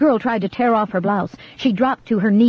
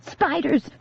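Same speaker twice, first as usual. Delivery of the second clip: high alarmed shout; sharp high cry; shouted warning; 9 semitones higher, 3 dB quieter than usual. A narrator's reading. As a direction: yell it to scare her. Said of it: "Grace shouted again".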